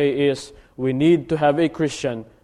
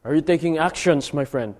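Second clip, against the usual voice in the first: usual speaking voice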